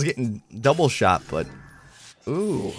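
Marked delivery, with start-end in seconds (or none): none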